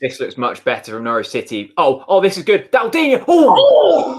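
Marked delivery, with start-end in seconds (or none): 1.8-4.2 high, loud laughing cry; excited yell. Real, not acted. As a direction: yell as the chance comes. Near goal excitement.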